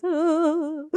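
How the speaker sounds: high, frightened ooh after a gasp; a gasp into a pretend fearful wail; scared noise. Puppeteer acting a scared puppet, frightened ooh. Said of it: "Bit of scared noise".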